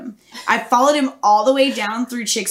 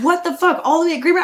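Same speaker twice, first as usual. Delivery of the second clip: incredulous driver; 7 semitones higher, faster than usual. Retold or imitated. Driver quoted.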